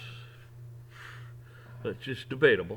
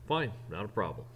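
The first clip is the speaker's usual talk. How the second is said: ordinary talk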